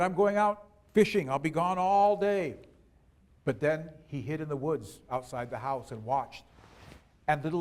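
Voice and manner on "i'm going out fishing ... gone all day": loud announcement. A narrator's reading. Husband tells wife he is leaving, loud announcement. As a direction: call it out so she surely hears.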